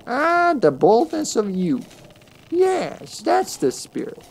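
put-on high, delighted old crone. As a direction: relish the girl's boldness like a witch. Crone praises Kid's boldness.

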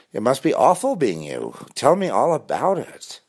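high, put-on concern; mock-caring, sarcastic lilt; sarcastic sympathy. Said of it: "And then the sarcasm".

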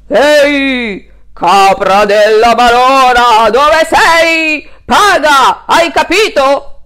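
very loud, slow angry yelling; greedy landlord storming in; bellowing landlord. Acted. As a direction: roar at the goat to pay up. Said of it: "Chi sbraitava".

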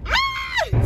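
very high joyful shriek; excited squeal. Real, not acted. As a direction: squeal with delight.

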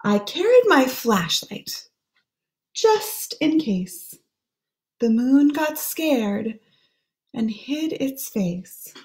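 ordinary delivery

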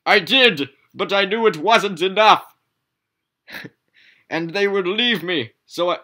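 high, loud dramatic confession; melodramatic pizza. Acted. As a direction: play it as a tragic lover.

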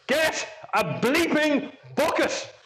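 high, slow mock scream, no real volume; retold scream. Colleagues at the webcam urging the engineer below, mock scream. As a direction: imitate screaming, drawing out each word high. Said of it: "we were screaming at the webcam".

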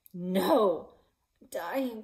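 loud desperate outcry; anguished cry. A narrator's reading. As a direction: cry out in despair. Anguished reaction to loss.

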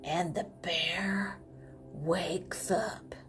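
low hushed whisper for suspense. Acted. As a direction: whisper it so the kids lean in. Suspense moment in story.

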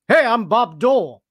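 deliberately bad impression; lazy Bob Dole impression. Mocking lazy impressionists, hammed-up Bob Dole. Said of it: "I got an impression. It's of Bob Dole".

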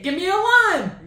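high pleading yell; desperate shout. Real, not acted. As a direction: shout for relief. Desperate call for lime.

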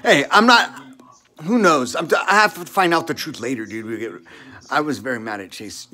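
ordinary delivery

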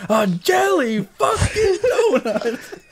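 very high, drawn-out drill-sergeant yell; Gunny. Acted. Drill sergeant exploding at a recruit over a donut, outraged yell. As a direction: explode slowly, stretching every word in mock outrage. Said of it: "What about Gunny from, uh, Full Metal Jacket?".

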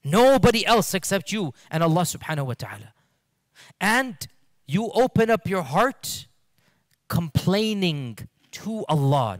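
ordinary delivery

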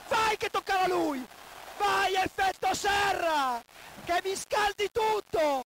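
screaming goal celebration; breathless italian goal commentary. Real, not acted. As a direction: ecstatic fan yelling for the winner. Seedorf winner celebration.

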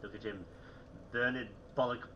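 ordinary talk, nothing marked

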